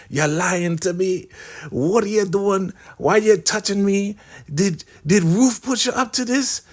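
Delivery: high-pitched, hammed-up questioning; indignant accusation. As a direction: imitate an affronted woman demanding answers. Acted woman accusing the man.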